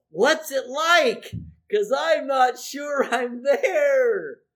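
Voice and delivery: a slow, loud imagined question from a churchgoer; voiced listener's question. A pastor voices a doubting listener's question, slow and loud. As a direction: call out the question, slow and unsure.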